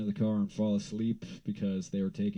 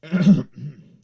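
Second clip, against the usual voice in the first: loud throat clearing; 6 semitones higher, 14 dB louder than usual. Real, not acted. Throat clearing after impression.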